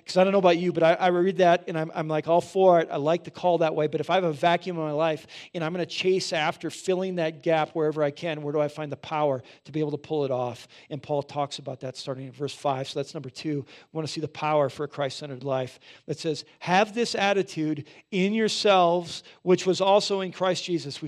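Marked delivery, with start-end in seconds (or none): 0.1-10.7 louder, fervent preacher's build; preaching at full force. Acted. 16.6-21.0 higher, louder, slow proclaiming of the verse; slow, ringing pulpit read; forceful scripture reading. Acted.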